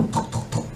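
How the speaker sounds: unvoiced imitated knock sound; whispered knocking. Mother knocking on the car window, whispered knock.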